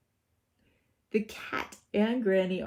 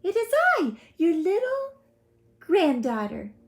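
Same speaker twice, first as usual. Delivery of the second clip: put-on 1 little girl; 11 semitones higher, 5 dB louder than usual. A narrator's reading.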